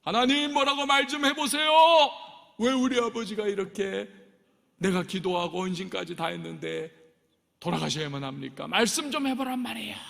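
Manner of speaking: missionary screaming at a silent God, enraged; furious shout. Retold or imitated. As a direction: demand an answer, shouting. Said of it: "하면서 막 소리를 지르고 있는데".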